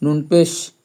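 ordinary talk, nothing marked

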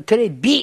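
テレビ with a hard, punched ビ; mock the crude b in テレビ; crude b pronunciation. Host mocking TV people, テレビ with a blunt b. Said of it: "センスの粗雑さね".

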